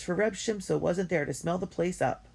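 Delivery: ordinary delivery, nothing marked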